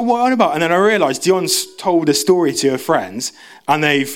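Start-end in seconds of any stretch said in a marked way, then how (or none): 0.0-0.5 high, loud incredulous squeak; mock baffled reply. Telling the rumour-spreader, high and baffled.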